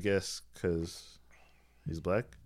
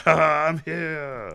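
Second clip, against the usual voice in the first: loud, high and drawn-out mock entrance; hammed-up heroic announcement; triumphant arrival call; 9 semitones higher, 8 dB louder, much slower than usual. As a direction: sing out the arrival, slowly. Acts Thor's late grand arrival.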